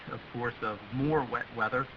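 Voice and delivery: ordinary talk, nothing marked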